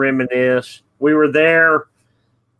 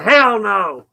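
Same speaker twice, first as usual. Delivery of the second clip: raised-pitch mock holler back; retold yell; 5 semitones higher than usual. Passer-by answering the pickup driver, mock yell. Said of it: "I yelled out".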